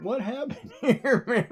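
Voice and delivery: high, slowed, laughing through the words; giggling through a pained question; laughing disbelief. Retold or imitated. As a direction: laugh as you ask what went wrong. Quoting herself mid-cramp, laughing.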